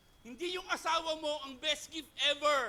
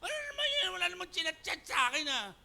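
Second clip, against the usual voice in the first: mock alarm; 7 semitones higher, faster than usual. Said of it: "Sabi ko".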